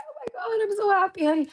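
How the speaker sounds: squealed way up high, half laughing; hammed-up happy squeal; mock gushing cry. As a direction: gush like you're overjoyed and tearing up. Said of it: "I'm like".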